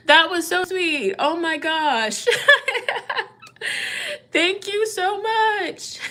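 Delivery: high tearful thanks with laughter; laugh-crying, stretched-out gratitude; emotional. Real, not acted. Reader thanking viewer for a sweet gift, laughing through tears. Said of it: "I'm emotional".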